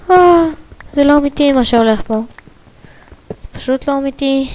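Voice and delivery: high, fast amazed disbelief. Real, not acted. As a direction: gush in disbelief. Amazed at find.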